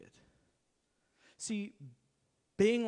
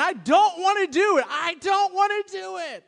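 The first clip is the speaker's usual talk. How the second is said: high, loud whine of refusal; comic high wail of "I don't wanna"; whiny protest; 12 semitones higher, 11 dB louder than usual. Real, not acted. Whining refusal.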